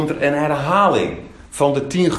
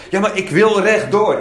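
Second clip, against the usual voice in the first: higher, insistent objection; mock protest; 7 semitones higher, 4 dB louder than usual. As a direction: play a mildly stubborn objector. Voices an objector refusing to turn.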